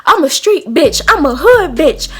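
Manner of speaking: mock her acting hard; hammed-up street-tough posturing; mimicked tough boast. Mimicking opponent's boast mid-argument.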